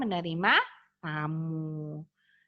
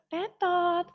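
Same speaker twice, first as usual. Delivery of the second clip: buzzer sound; 8 semitones higher than usual. Shown as a demonstration. Wrong-answer buzzer for class.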